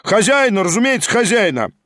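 high, eager nomination; calling out. A narrator's reading. Members nominate the host as chairman, calling out. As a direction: throw the name in eagerly.

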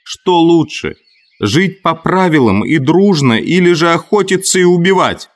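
high-pitched impassioned shout; urgent high oratory, pushed to be heard; yelling. A narrator's reading. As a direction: hurl the question over the uproar. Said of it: "Хрюша снова орал".

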